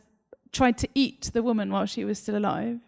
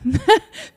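gleeful laugh; 10 semitones higher, 8 dB louder than usual. Real, not acted.

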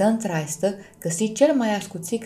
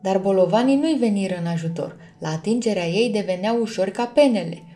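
ordinary delivery, nothing marked

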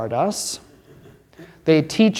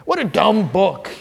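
mock sneering skeptic; 8 semitones higher than usual. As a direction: scoff like a smug critic, pitched up. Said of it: "He said".

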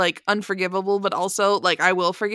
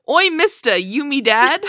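higher, cheeky mock-British street urchin; bad British accent; 5 semitones higher, 4 dB louder than usual. Host reading a listener's joke review, bad British accent. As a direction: put on a hammy British accent. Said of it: "do a bad British accent".

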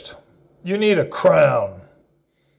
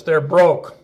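usual speaking voice

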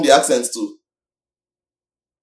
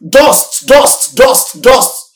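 each dust punched louder and higher; emphatic repetition; 5 semitones higher, 4 dB louder, much slower than usual. Real, not acted. Preacher scolding idle listeners, repeating dust emphatically. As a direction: hammer dust, dust, dust home to the congregation. Pastor hammering one word.

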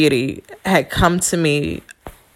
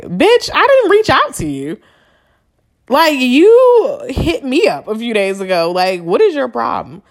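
retold angry comeback; 7 semitones higher than usual. Telling off the ex who called her, indignant snap. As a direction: fire it back at him, fed up. Snapping back at ex on phone.

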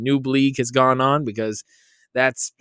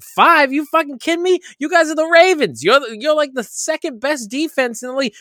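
much higher, louder disbelieving outburst; mock outraged; 9 semitones higher, 3 dB louder than usual. Retelling his fantasy collapse, a mock outraged outburst. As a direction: explode at the Ravens in disbelief. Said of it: "And then I was like".

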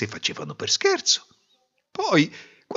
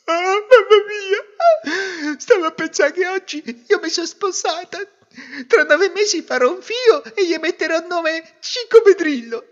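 high, loud theatrical sobbing voice; weeping uncontrollably; 10 dB louder than usual. Acted. Daughter explaining her grief to mother, wailing. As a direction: wail it out, building to a shriek. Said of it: "trovò la figlia che piangeva da non poterne".

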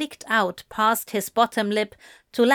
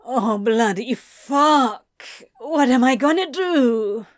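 despairing outburst; 3 semitones higher, 5 dB louder than usual. Acted. Despairing outburst at his blunder.

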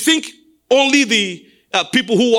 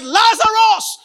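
high, ringing call to the dead; shouted call; 10 semitones higher, slower than usual. Acted. Jesus calling Lazarus out from the dead, shouted. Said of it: "Jesus shouted to call out the dead".

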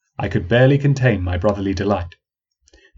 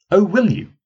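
much higher, skeptical challenge; woman doubting the old man; 10 semitones higher, 3 dB louder than usual. Acted. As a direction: dare him to answer. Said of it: "asked the woman".

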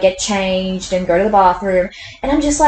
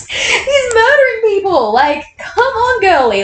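high, slow, whining protest; pitched-up, drawn-out complaint; exasperated whine; 13 semitones higher, slower than usual. Real, not acted. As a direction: wail it in outraged disbelief. Outraged at Bella's indifference.

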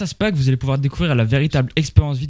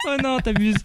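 high-pitched, cracking up; helpless laugh at a crude joke; laughing protest; 10 semitones higher than usual. Real, not acted. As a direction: protest through real laughter.

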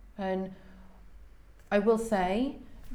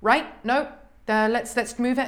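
brisk, louder, no-nonsense turn; all business; 5 semitones higher, 8 dB louder than usual. Retold or imitated. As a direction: snap into business mode. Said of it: "she went business completely".

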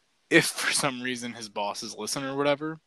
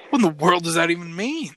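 high, loud mock bafflement; retold cry of exaggerated disbelief; incredulous; 7 semitones higher, 7 dB louder than usual. Golfer reacting to dad's odd remark on the swing, incredulous.

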